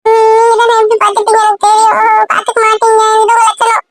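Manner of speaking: put-on high, whining child's complaint; tearful accusing. Child blaming the man for not protecting her, tearful. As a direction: whine the reproach through tears.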